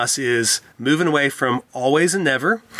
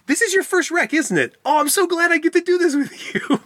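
much higher, delighted retold father; excited dad. Retelling a dad excited with his daughter after a wreck, delighted. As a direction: beam with delight at her first wreck. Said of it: "he was actually excited".

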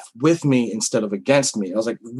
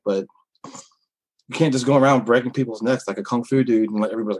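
ordinary talk, nothing marked

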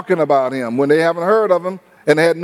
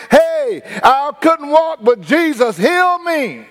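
excited healed man; 7 semitones higher than usual. Acted. Healed lame man tells the news.

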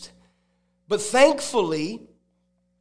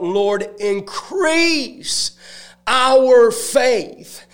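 high, drawn-out plea, swelling louder; crying out; 7 semitones higher, 4 dB louder, much slower than usual. Acted. Disciples begging the Lord for more faith, crying out. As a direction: cry it out slowly to the Lord, building in volume. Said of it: "the disciples cried out".

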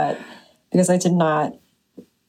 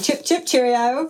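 mock British; 4 semitones higher than usual. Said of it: "That's what I do ... in London".

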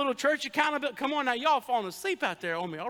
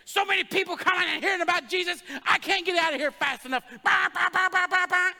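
higher, louder grumbling churchgoer voice; impatient churchgoer; 6 semitones higher, 4 dB louder than usual. Acted. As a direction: grumble like someone stuck in the parking lot. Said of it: "voicing an impatient churchgoer".

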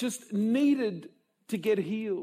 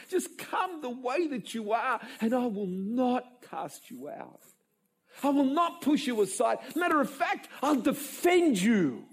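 reassuring promise; 4 semitones higher than usual. Retold or imitated. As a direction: reassure her, then promise protection. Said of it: "Whereas Jesus was".

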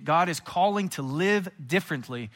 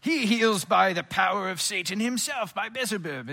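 troublemakers' voice; 5 semitones higher, 4 dB louder than usual. Acted. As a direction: put on a pompous accuser. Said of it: "that's how they talked".

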